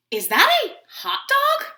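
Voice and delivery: high, loud rising question; high cartoon duckling voice; curious duckling. A narrator's reading. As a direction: pipe up as an inquisitive little duckling.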